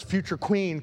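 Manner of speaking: normal talking voice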